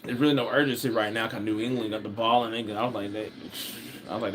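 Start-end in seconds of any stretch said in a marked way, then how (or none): none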